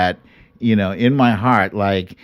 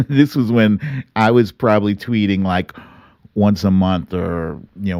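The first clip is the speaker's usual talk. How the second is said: ordinary delivery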